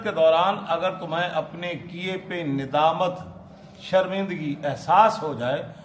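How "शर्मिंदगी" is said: ordinary delivery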